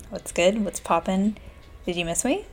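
ordinary delivery

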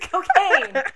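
high, loud blurted punchline; loud joke shout. Acted.

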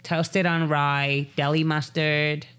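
slow, drawn-out tipsy ordering; mock wasted Valley girl. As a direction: drag out the order like a drunk customer.

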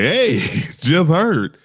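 loud, drawn-out mock 'hey', pitched high; przesadnie beztroskie zagajenie. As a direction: imitate a clueless, too-breezy caller, slowly. Imitates a careless late condolence message.

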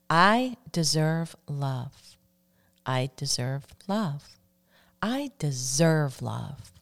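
slow, deliberate affirmation for listeners to repeat. Shown as a demonstration. Host leading radio listeners in a love affirmation, slow and deliberate. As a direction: let each word land, unhurried. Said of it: "Say that with me now".

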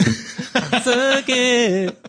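high gloating laugh through the words; gleeful laughing jeer; laughing taunt. Real, not acted. Gloating over winning debate.

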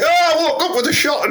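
squeaky high shouted catchphrase; shrieking the daft catchphrase; mock catchphrase. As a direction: yell it way up high like a silly catchphrase.

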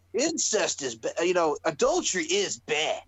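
put-on slow Boston accent, words drawn out. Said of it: "in the Boston accent".

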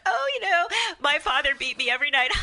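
high, breezy start breaking into a laugh; laughing off trauma. Acted. People laughing about trauma say it, laughing it off. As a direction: toss it off lightly, then crack up. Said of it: "People will laugh about trauma".

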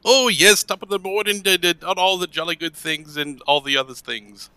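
high-pitched jolly-good gentleman; super proper. Acted. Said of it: "super proper in Aquan".

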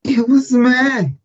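high, slow exasperated groan; drawn-out mock outrage. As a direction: stretch it out, fed up. Exaggerated verdict on episode.

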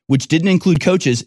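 ordinary talk, nothing marked

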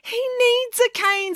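high, slow, strained protest; teacher at the end of patience; exasperated. Acted. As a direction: stretch it out in exasperation. Teacher defends blind pupil, exasperated.

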